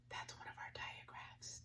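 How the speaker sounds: hushed, surprised whisper to the class; whispered teacher aside; excited whisper. Acted. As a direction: share a secret discovery.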